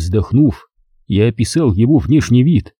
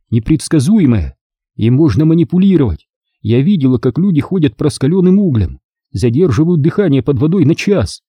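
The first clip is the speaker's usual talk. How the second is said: put-on troubled older woman, higher pitch; 4 semitones higher than usual. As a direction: recall a puzzling loss gently. Leonora, bitter smile.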